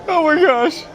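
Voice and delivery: high, gasping through laughter; laughing. Real, not acted. Streamer losing it over the clip, laughing. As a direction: barely get it out between laughs.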